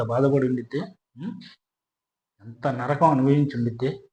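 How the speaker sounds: hushed and halting, long pauses between phrases; real sorrow, quiet and faltering; pained, sorrowful. Real, not acted. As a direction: speak low and soft, heavy with pity for the victim. Grieving over victim burned alive.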